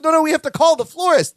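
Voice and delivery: quick, high-pitched mock panic; frenzied. Marianne pushing back at her husband, frenzied. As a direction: fire it back high and fast, imitating frenzy. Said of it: "they get all frenzy".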